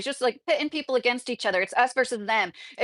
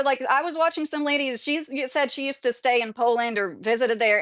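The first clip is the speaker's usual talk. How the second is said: usual speaking voice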